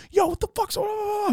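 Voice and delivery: very high-pitched yell; hammed-up frantic high holler; manic greeting. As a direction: shout it out in a squealing voice. Greeting his homie while high.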